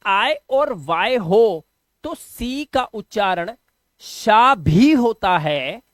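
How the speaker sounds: very slow, each word spaced out; slow careful explaining. Shown as a demonstration. Teacher dictating the rule slowly so students can follow. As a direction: spell the rule out slowly for beginners. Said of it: "ध्यान से देखिए".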